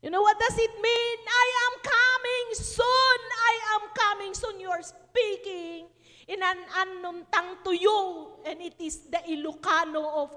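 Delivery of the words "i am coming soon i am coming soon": retold prayer cry. Preacher revealing the prayer's meaning, loud proclaiming voice.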